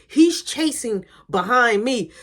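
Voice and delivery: normal talking voice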